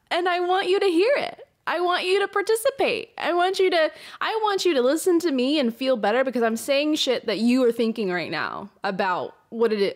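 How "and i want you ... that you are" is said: fired-up pitch to the audience; impassioned appeal. Real, not acted. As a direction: urge them to join you. Passionate appeal to listeners.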